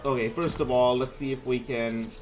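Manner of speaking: ordinary talk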